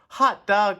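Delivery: high, loud cheer; excited cry. A narrator's reading. Kids welcoming their real teacher, excited cry. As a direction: cheer like a class of pupils. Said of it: "cried the kids".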